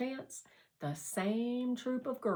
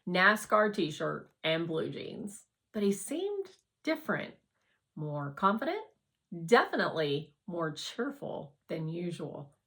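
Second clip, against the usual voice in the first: ordinary delivery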